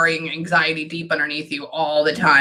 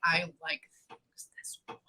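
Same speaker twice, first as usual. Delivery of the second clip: whispered quote; 16 dB quieter than usual. Retold or imitated. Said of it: "She was like".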